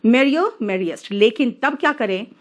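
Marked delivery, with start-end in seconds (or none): 0.0-1.1 very slow, each word drawn out; merrier, merriest spelled out slowly for the class; slow demonstration. Shown as a demonstration. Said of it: "प्रसन्न यानी खुश". (बहुत धीरे, एक-एक शब्द खींचकर)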